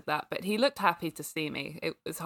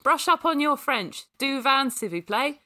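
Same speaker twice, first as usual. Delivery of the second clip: put-on high, loud teenage order; high-pitched and final, easing off at the end; bossy finality; 8 semitones higher, 6 dB louder than usual. A narrator's reading. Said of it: "the finality in her voice".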